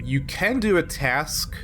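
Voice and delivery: ordinary talk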